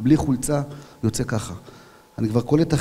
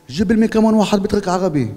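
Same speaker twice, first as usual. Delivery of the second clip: raised Arabic-speaking voice; higher, louder Arabic accent; 7 semitones higher, 5 dB louder than usual. Retold or imitated. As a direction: imitate a man calling in Arabic. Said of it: "במבטא ערבי".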